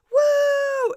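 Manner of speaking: loud, high whoop; crowd cheer. Retold or imitated. The casino crowd cheering the win, a loud high whoop. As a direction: let out a big whoop.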